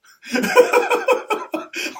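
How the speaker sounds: a sudden high, airy burst of disbelieving laughter; high breathy laugh. Real, not acted. Laughs at the player's refusal.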